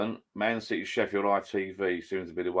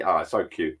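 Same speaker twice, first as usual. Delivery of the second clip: ordinary talk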